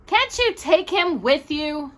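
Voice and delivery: high, slow whiny plea; whined. A narrator's reading. Older brother to parents, whining. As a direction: whine it like a put-upon teen. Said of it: "Walter whined".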